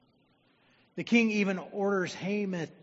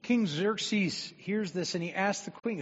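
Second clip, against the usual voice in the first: usual speaking voice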